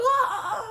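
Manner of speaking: sudden high shriek of a victim; bloody scream. Retold or imitated. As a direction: let out a short, piercing scream. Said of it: "bloody scream at them".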